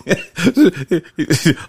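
high bursting laugh; loud delighted laugh; big laugh. Real, not acted. Son laughs at dad's age.

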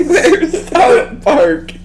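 high and loud, very slow, laughing through it; laughing repeat. Retold or imitated. Friend repeating his own sleepy question, slow laughing high. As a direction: drag it out while cracking up.

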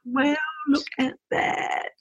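high, hushed marvelling, slowing down; acted little gasp of wonder, pitched high; pleasant surprise. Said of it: "do something that surprises me".

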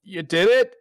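high, stammering nervous barkeep; a jittery host checking he's safe. Acted. GM voices nervous tavern owner greeting the party, high and stammering. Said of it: "He says nervously".

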